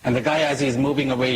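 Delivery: ordinary talk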